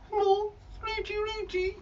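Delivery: high, whiny bird voice; sulky bird puppet begging; whining puppet bird. Acted.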